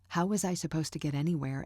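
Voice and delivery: usual speaking voice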